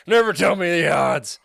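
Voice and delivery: lightly voiced swaggering space pilot; a hint of a cocky smuggler; movie hero impression. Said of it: "How about that impression?".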